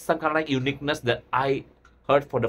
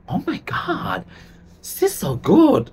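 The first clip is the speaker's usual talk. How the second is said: high, louder retelling of an awed reaction; amazed gasp; 8 semitones higher, 4 dB louder than usual. Retold or imitated. Reactor retelling first hearing [name]'s music video, amazed. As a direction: gush in amazement, pitched up high. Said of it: "I was like".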